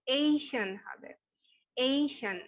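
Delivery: এশিয়ান said slowly as eishan, ending falling; teacher's model pronunciation. Shown as a demonstration. Teacher models এশিয়ান for students, slow and careful. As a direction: model এশিয়ান clearly for the class. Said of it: "উচ্চারণটা এশিয়ান হবে".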